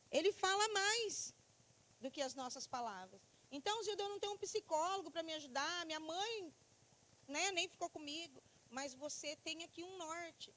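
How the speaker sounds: ordinary talk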